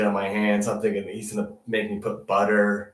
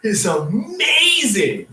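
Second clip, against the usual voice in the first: amazed; 10 semitones higher, 5 dB louder, slower than usual. Retold or imitated. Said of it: "'cause I was like".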